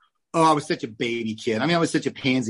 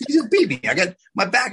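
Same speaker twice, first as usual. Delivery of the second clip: put-on hurt kid; 6 semitones higher than usual. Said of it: "I'm like".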